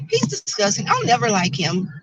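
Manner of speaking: very high squeaky mocking voice; shrill it out like a catty girl; mocking Tracy. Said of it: "this is Tracy".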